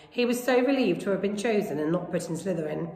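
ordinary delivery

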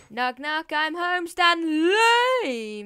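shouting to Stanley that he's home; loud call. Real, not acted. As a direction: call out across the house. Calling out arriving home.

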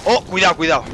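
high startled cry of caution; alarmed warning. Real, not acted. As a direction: yelp it in sudden alarm. Enemy attacks in game.

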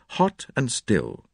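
ordinary delivery, nothing marked

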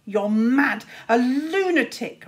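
put-on a bitten boy raging at his rival; raging insult. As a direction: hurl it in fury. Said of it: "raged Luther".

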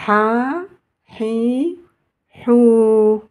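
"Ha, Hi, Hou" with each vowel stretched; long vowels. Shown as a demonstration. Teacher runs through the long vowels, "Ha, Hi, Hou" each held. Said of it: "la voyelle longue".

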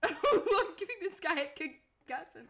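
loud, high burst of laughter into words; laughing. Real, not acted. Streamer amused at giving the guy a concussion, laughing. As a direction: talk through a laugh.

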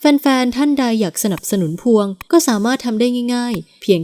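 bright, friendly ad read for the channel; channel promo read. Acted. Host promoting the channel to listeners, in a cheerful ad read. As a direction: ask listeners to like and subscribe.

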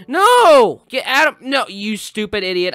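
high, loud shout. Real, not acted. Gamer yelling at a racer on a cloud, loud shout. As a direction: yell at the racer. Hit in race.

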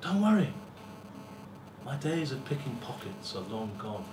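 usual speaking voice

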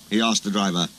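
ordinary delivery, nothing marked

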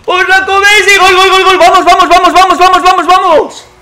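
high, loud goal yell; goal celebration. Real, not acted. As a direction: scream the goal in triumph.

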